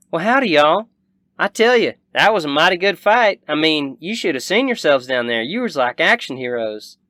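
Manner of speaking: high, loud country hostess; lively cowgirl voice, admiring; friendly Southern rancher woman, impressed. Acted.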